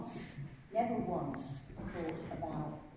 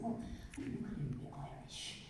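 conspiratorial aside; 3 semitones lower, 6 dB quieter than usual. Retold or imitated. Retold scheming thought.

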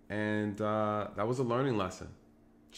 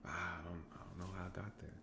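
muttered denial; 5 semitones lower, 16 dB quieter than usual. Retold or imitated. Suspect quoted very quietly.

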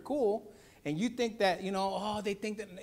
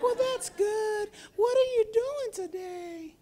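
much higher, slow cartoon toy voice; toy puppet voice; 5 dB louder, much slower than usual. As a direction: play a little toy greeting a child. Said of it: "I'm like".

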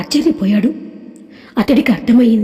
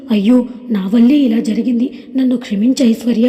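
usual speaking voice

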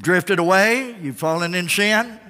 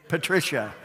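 normal talking voice

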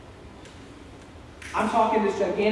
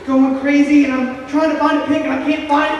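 raised, loud frantic storytelling; frantic retelling; 5 semitones higher, 7 dB louder than usual. Retold or imitated. Retelling a panicked search for a pick, frantic and loud. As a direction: push it higher and louder, frantically.

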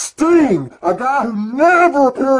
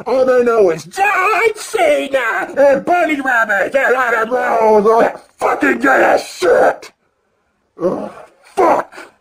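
high, shouted rant, slow at the start; furious fan shouting; angry shout; 5 semitones higher than usual. Real, not acted. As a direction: yell in disgust at the crowd. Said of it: "I'm excited and I'm angry".

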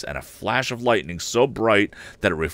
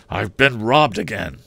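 higher and louder, dismayed cry; exclaimed; 3 semitones higher, 5 dB louder than usual. Acted. Father exclaims to his son he's been robbed. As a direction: blurt out the bad news. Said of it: "and exclaimed".

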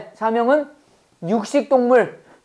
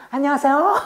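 high, sweet, put-on phone greeting; mom's phone voice; 5 semitones higher than usual. Mom answering a call mid-scolding, put-on sweetness. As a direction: switch to instant sugary politeness. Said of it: "어, 저 전화 오잖아".

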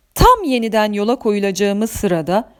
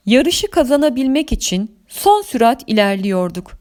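usual speaking voice

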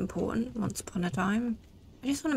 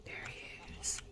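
soft unvoiced whisper; 15 dB quieter, slower than usual. Real, not acted. Spotting the enemy, the player whispers. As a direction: breathe it out quietly.

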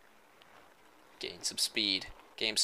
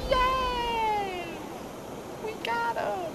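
squeaky character voice; much slower than usual. Pretend. High put-on voice for character.

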